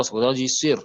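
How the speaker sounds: ordinary delivery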